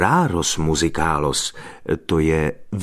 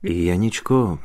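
ordinary delivery, nothing marked

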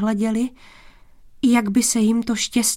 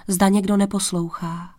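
ordinary delivery

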